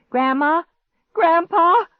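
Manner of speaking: loud, high call for help; crying out. A narrator's reading. Girl calls her grandparents for help, crying out. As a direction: holler through the house in panic. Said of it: "I cried out".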